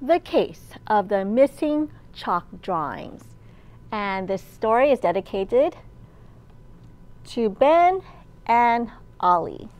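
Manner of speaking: lively picture-book storytime voice; reading to children. A narrator's reading. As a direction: read it aloud to a room of little kids.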